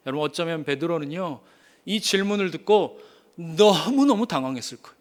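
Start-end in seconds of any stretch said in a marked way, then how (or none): none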